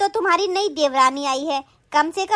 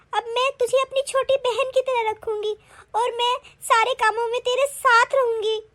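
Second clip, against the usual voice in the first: high, weepy apology; tearful crying; 6 semitones higher than usual. Acted. Elder sister-in-law asking the younger one's forgiveness, crying. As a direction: plead and promise through tears. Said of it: "दीदी आप रोइए नहीं".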